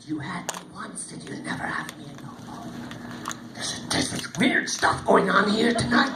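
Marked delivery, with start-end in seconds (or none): 0.1-2.7 hushed unvoiced whisper. Lady whispering her parting riddle to the warriors. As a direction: breathe the farewell secretly.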